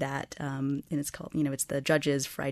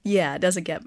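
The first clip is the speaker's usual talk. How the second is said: normal talking voice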